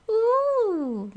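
very high, loud mock ooh. Kids laughing at the fallen boy, very high mock ooh. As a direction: tease him with a hooting ooh. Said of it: "Trent heard some going".

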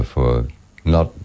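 usual speaking voice

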